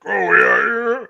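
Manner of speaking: puffed-up posturing. Retold or imitated. Imitating puffed-chest men squaring off.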